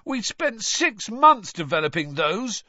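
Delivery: high, loud protest; indignant shout. A narrator's reading. Son protesting as mother bins toffees, shouting. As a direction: shout it in outrage at lost work. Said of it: "Fred shouted at his mother".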